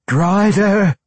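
high loud call from afar; distant cry. A narrator's reading. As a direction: call out across the forge. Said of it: "came a cry".

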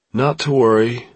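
put-on breezy drunken reassurance; a hint of drink in the voice. A narrator's reading. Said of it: "He sounded drunk".

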